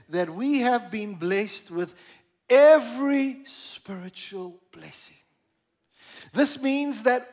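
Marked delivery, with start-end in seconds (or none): 2.5-5.2 "every" hit hard and drawn out; slow down and punch "every"; stress on every. Shown as a demonstration. Preacher stressing the scripture to the congregation, slow, weighty stress on "every". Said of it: "I want to emphasize the word every".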